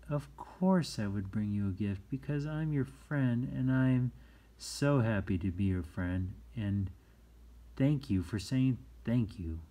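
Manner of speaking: ordinary talk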